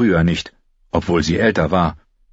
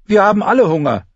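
put-on high, louder and fast girl's retort; Arya's retort; 14 semitones higher, 4 dB louder, faster than usual. A narrator's reading. Arya answers the whining boys, quick retort. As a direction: snap it back quickly.

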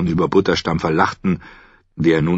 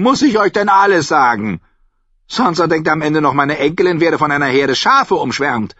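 high, loud voice of a mocking old noblewoman; a sly, tart old lady holding court; Olenna Tyrell; 13 semitones higher, 4 dB louder than usual. A narrator's reading. Olenna mocking a rival to Sansa, high and loud.

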